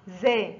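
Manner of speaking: زا said with the tongue kept behind the teeth; teacher shows زا with the tongue inside. Shown as a demonstration. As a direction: model زا without the tongue showing. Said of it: "لا نخرج اللسان عند النطق بحرف الزاي".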